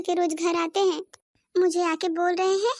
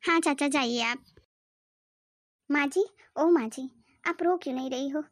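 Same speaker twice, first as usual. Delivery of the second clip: ordinary delivery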